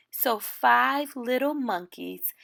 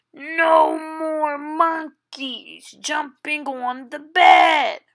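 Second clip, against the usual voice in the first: doctor shouts the warning, louder at the end; exasperated doctor shout; 4 semitones higher, 4 dB louder than usual. Acted. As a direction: build to a fed-up yell on bed.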